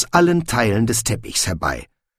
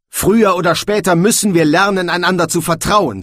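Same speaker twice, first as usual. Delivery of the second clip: high, raised voice in exasperation; shouting; 8 semitones higher, 5 dB louder than usual. A narrator's reading. Young man urges the quarrellers to trust, shouting. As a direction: raise your voice, pitched high and insistent. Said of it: "dass er geschrien hatte".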